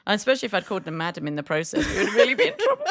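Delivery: quick, high words breaking into laughter; laughing. Real, not acted. Imagining calling a customer madam, laughing hard. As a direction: crack up while talking. Both cracking up over the joke.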